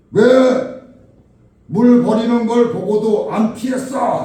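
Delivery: loud, high scolding; angry accusation. Retold or imitated. Woman blames the drenched man for not dodging, angry shout. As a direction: yell it as blame.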